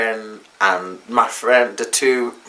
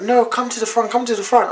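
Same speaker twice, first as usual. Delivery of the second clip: driver insisting; 11 semitones higher, faster than usual. Retold or imitated. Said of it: "He's like".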